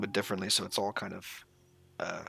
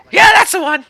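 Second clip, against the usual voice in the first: squeaky kobold voice; 16 dB louder than usual. Acted. Kobold NPC answering.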